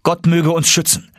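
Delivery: high, rousing call; resolute cry. A narrator's reading. Nephew, moved by uncle's words, agrees, resolute cry. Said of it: "und deshalb rief ich".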